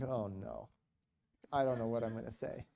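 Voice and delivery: low, soft aside to yourself; inward dread. Retold or imitated. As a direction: murmur it with mild dread. Dreading priest sitting beside him.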